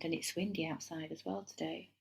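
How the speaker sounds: normal talking voice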